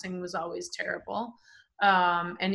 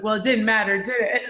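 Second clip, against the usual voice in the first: smug; 2 semitones higher, 8 dB louder than usual. Retold or imitated. Cocky retort to beaten opponent.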